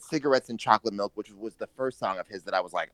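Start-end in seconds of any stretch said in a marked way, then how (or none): none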